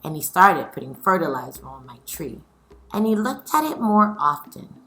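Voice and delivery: lively read-aloud storytelling; story reading. A narrator's reading. Teacher reading a picture book aloud to her scholars, lively.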